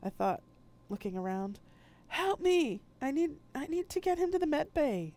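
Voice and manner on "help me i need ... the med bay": much higher and louder plea; yelling for help. Acted. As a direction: shout at the people in the hall. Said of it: "I yelled".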